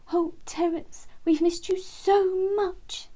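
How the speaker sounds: high, slow tearful mother bird; a mother relieved to find her baby; high slow emotional mum wren. A narrator's reading. Mum wren greeting lost son.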